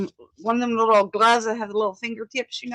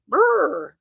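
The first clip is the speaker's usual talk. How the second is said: real shiver sound from the cold; a quick cold brr through the lips; 7 semitones higher than usual. Real, not acted.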